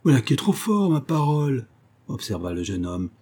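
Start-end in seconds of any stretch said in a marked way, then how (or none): 0.0-1.7 high incredulous protest, loud on the name; exclaim, taken aback by her accusation; indignant astonishment. Acted. (protestation aiguë, stupéfaite et indignée)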